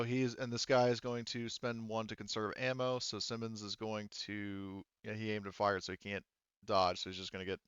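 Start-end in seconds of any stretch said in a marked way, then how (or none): none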